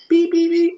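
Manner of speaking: high, even little beeps; imitated bus beeping. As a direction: mimic a small bus horn in a high pitch.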